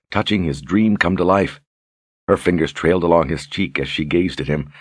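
usual speaking voice

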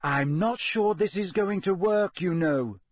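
warning. A narrator's reading. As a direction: caution them, a little prim. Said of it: "said Hermione warningly".

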